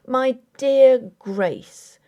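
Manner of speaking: slow, soothing call to his wife; gently calm her down; tender address. A narrator's reading.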